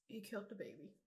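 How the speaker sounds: hushed grieving repeat. Real, not acted. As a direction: let it sink in, quietly. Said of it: "I'm sorry if I'm getting emotional".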